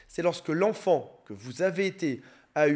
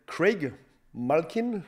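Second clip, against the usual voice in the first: put-on English accent; much slower than usual. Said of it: "appréciez mon accent anglais".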